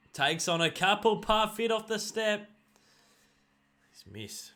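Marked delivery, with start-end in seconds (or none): 0.1-1.2 louder mock commentator call, rising on couple; play-by-play call lifting on couple; excited call. 1.2-2.5 higher, louder, slower call of Parfit; hammed-up footy commentator; excited commentator call.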